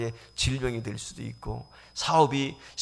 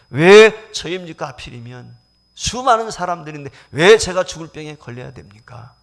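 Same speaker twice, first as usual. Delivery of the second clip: defiant outcry; 4 semitones higher, 14 dB louder than usual. Retold or imitated. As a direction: rage against God. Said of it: "라고 했던 이". (하나님께 대드는 고함)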